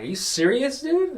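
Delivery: retold indignant question, pitch shooting up; mad. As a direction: imitate your own incredulous anger, rising high on "dude". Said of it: "I got so mad".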